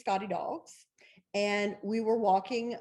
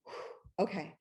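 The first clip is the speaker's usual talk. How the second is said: breathy whispered whew, then a very soft okay; steady yourself under your breath; nervous breath; 3 semitones lower, 8 dB quieter, slower than usual. Retold or imitated. Author bracing herself before answering the phone, whispered. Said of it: "I was like".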